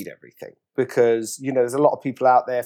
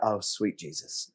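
hushed dread; 5 semitones lower, 11 dB quieter, slower than usual. Retold or imitated. Sees the empty chair.

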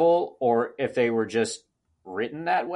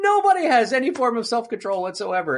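ordinary talk, nothing marked

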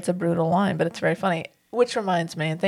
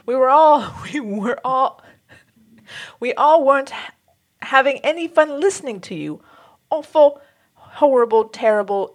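put-on horrible British accent; 5 semitones higher, 3 dB louder than usual. Mock-scolding nerd girls from a listener's letter, horrible British accent. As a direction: scold them in a bad, theatrical British voice. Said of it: "do a horrible British accent".

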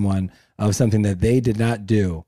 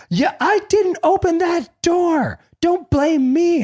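slow, much higher mock wife voice; mimicking his wife; slower than usual. Husband mocks his wife's denial in a much higher voice. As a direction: whine it like the accused wife.

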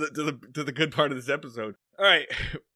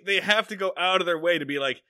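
normal talking voice

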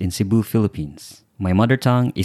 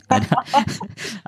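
a burst of high laughter at the joke; 13 semitones higher than usual. Real, not acted. Joke about karaoke.

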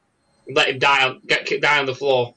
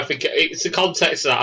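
ordinary talk, nothing marked

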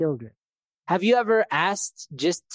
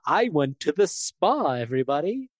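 mock public confession. Man owning up to his spa trip, mock confession. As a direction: announce it to the room, stretched out. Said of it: "It's kinda like you whisper it".

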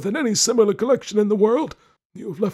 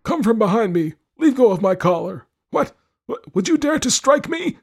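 high, loud, sharp outrage; 8 dB louder than usual. A narrator's reading. Lord orders wife off his collar, sharp. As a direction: bark it as an alarmed, furious old lord. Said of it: "say in a sharp voice".

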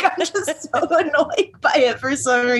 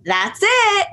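high-pitched nurse imitation; mock nurse reassurance; 10 semitones higher than usual. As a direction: sing out a forced confirmation. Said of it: "force the nurse to have to go".

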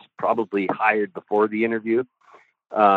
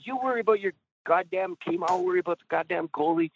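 high-pitched retold snapping at the boss; retold cussing out; 8 semitones higher, 5 dB quieter, faster than usual. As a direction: snap back in a high, fast voice without shouting. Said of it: "I'm cussing Mike out".

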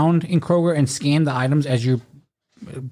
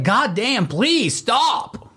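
exasperated plea; 8 semitones higher, much slower than usual. Retold or imitated. Acted plea to question-asking classmates.

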